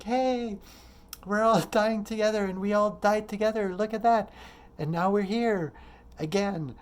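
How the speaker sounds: high, loud, jubilant cry; joyful. Acted. Dying souls greeting each other after turning into life, joyful. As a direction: celebrate like souls singing out with joy. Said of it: "as if a joy, like".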